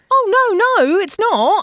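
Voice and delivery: high startled denial; surprised protest. Retold or imitated. English driver protesting it isn't VIP, surprised. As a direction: jump up in pitch, protesting. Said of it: "I said".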